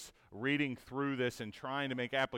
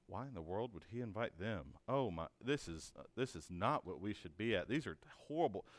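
low, much softer disapproving mutter; hushed scornful aside, lower; muttered inner grumble; 5 semitones lower, 8 dB quieter than usual. Retold or imitated. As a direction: grumble it under your breath like a scandalized elite. Pharisees thinking to themselves.

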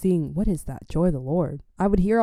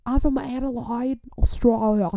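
higher, hammed-up Aussie accent; Australian accent; 6 semitones higher than usual. Mimicking minister from Adelaide, hammed-up Australian accent. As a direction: exaggerate broad Australian vowels. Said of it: "His accent's very scary".

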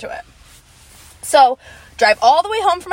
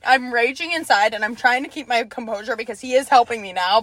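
ordinary talk, nothing marked

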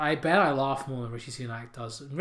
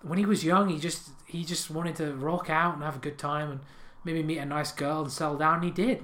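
normal talking voice

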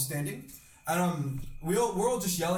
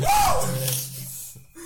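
gleeful whoop; 7 dB louder than usual. Retold or imitated. Cheering after his yell.

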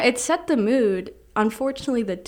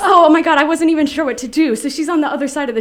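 breathless, rushed retelling, much louder and higher; excited; 6 semitones higher, 9 dB louder than usual. Real, not acted. Host gushing to listeners about meeting [name], excited. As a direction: gush about meeting a star.

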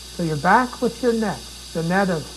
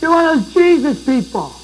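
high, loud taunting voice; high mock-scornful accusation; mocking accuser; 10 semitones higher, 8 dB louder than usual. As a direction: jeer like a scornful bystander. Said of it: "And people have said".

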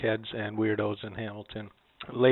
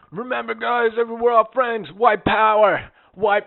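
high-pitched call after departing friends; mock yell, pitched well up; retold yelling; 11 semitones higher, 3 dB louder than usual. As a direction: imitate someone calling out as they leave. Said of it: "he's yelling it".

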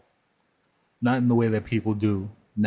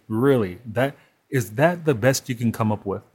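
usual speaking voice

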